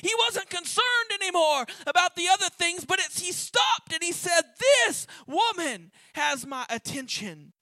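rising, loud revival preaching; impassioned sermon build. Acted. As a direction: preach it out to the congregation. Preaching at full force.

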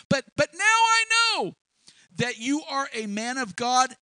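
high and loud, full of new faith; joyful realisation. Acted. Widow to Elijah after her son revives, thrilled. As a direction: let her faith burst out.

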